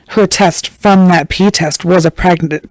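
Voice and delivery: normal talking voice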